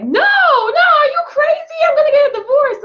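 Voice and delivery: high, loud mock panic; panicked husband. Husband refusing to hand over the phone, panicked.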